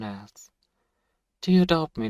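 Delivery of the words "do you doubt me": mocking outburst; sudden loud taunt. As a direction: burst out loud and higher. Vampire taunting captive.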